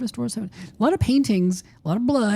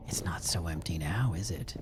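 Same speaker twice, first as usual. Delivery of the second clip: spooky whisper; 12 semitones lower, 9 dB quieter than usual. Pretend.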